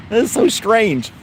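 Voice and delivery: high, slow, still laughing; amused disbelief. Real, not acted. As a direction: marvel at it, amused. Laughing after deer story.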